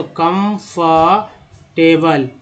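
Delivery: Comfortable drawn out very slowly, ending एबल; slow demo. Shown as a demonstration. Teacher sounding out the word for students, very slowly. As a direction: stretch each part of comfortable. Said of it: "ABL को एबल बोलते हैं".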